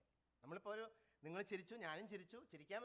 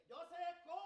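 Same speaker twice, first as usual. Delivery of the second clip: mock chicken call; 8 semitones higher than usual. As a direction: imitate a chicken to tease a boy. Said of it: "like a chicken over there".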